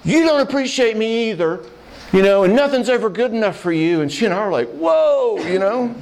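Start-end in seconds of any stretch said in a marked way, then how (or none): 0.0-4.0 higher-pitched mock indignation; imitated husband going off. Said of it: "he immediately just goes off on her". 4.7-5.9 high, mock-startled "whoa"; taken-aback whoa. Retold startled reaction of two listeners.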